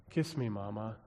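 put-on soft, quiet plea from a dying boy. A narrator's reading. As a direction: beg softly, barely above a breath. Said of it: "he softly said".

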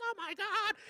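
very high, hushed mock screams; mock screaming. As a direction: imitate a crowd losing it, held back.

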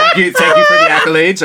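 laughing thanks. Real, not acted.

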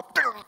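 high little keel-over sound; quick high imitated goat noise; fainting goat noise. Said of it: "And the goat would go".

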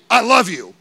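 "I love you" said loud and forceful, pitch slightly up; a bold, loud "I love you". Shown as a demonstration. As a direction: belt out "I love you" like a declaration. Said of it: "There's a complete different way that I'm saying that".

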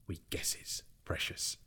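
breathy creature whisper. Acted. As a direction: hiss it under your breath. Gollum whispering to himself.

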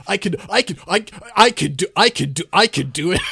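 loud, stammering pile-up of battle cries; mob cacophony. Retold or imitated. Imitating a mob of seven soldiers, loud overlapping shouts. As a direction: shout over yourself like seven enemies at once. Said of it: "pulled a mob of seven of them".

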